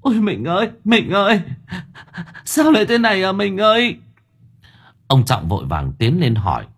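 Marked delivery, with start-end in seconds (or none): 0.0-0.1 high keening wail of grief; sobbing lament. Acted. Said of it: "vừa chạy đến đã gào khóc". 0.1-0.7 high wailing call to her dead husband; grieving wife's wail; wailing cry. Acted. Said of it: "đã gào khóc". 0.8-1.4 high wail, pushed harder; louder wailing cry. Acted. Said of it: "đã gào khóc". 2.4-3.9 high, grief-stricken wail; keening widow. Acted. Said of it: "đã gào khóc".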